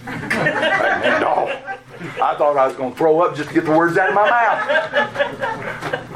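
high, breathy laughing through the words; slow, laughing admission; laughing confession. Real, not acted. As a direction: laugh at yourself while admitting it. Admits faking love, laughing.

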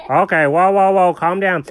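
usual speaking voice

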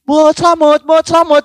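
high-pitched call-out, reenacted; retold yelling. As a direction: act out someone hollering. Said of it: "Bengak-bengok ngono kan". (niru wong bengak-bengok)

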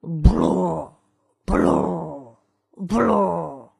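low mock oinks on the run; pig grunts. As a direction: keep grunting while running. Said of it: "还不停地叫唤着".

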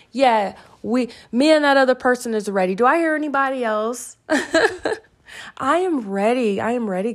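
rally the crowd at full energy; hyped-up excitement. Real, not acted. Host rallying listeners to say they are ready, excited.

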